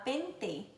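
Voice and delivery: "pente" ending in plain "teh"; regional hard T. Shown as a demonstration. Teacher showing other accents, "pente" with hard t. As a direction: say "pente" as PEN-teh, no tch. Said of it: "por causa da diferença em sotaque".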